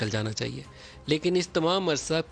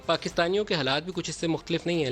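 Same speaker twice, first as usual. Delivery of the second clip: ordinary talk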